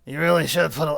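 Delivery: loud growling werewolf yell. Acted. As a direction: bellow it like a werewolf. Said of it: "Just pretend like it's a werewolf voice".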